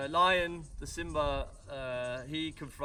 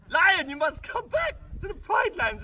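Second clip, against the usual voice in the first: much higher, louder pleading lioness voice; Nala pleading; 14 semitones higher, 7 dB louder than usual. Acted. Nala begs the lion to come home, high pleading voice.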